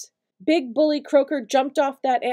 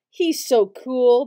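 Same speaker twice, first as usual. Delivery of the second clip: sighed; 4 dB louder, slower than usual. A narrator's reading. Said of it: "Bailey sighed".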